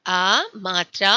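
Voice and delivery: normal talking voice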